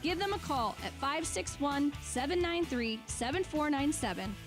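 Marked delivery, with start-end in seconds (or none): none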